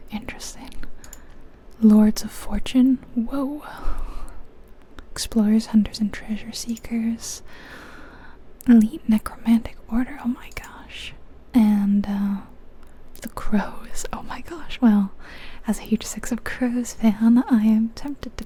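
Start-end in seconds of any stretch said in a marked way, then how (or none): none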